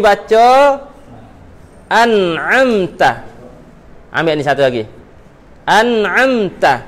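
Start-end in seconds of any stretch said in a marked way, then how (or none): 1.9-3.2 'an'amta' drawn out very slowly; slow correct reading. Shown as a demonstration. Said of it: "ni hak betul". 5.6-6.8 'an'amta' drawn out very slowly; slow correct reading. Shown as a demonstration. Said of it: "ni hak betul".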